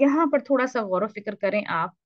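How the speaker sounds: ordinary talk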